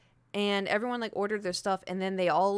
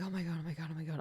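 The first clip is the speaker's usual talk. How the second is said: freaking out; 4 semitones lower, 8 dB quieter, much faster than usual. Retold or imitated. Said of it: "I was, like, freaking out".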